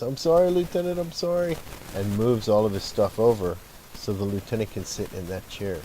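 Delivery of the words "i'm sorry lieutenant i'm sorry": much higher-pitched mock apology; quick-to-please firefighter voice, pitched way up; laughing apology, imitated. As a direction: imitate an eager, good-natured apology up high. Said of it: "laughs and says".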